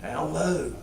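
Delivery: sinking quiet voice; down low. Shown as a demonstration. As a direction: let the voice sink right down. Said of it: "my voice going up and then down low".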